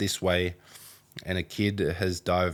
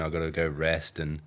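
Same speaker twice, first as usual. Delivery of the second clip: ordinary delivery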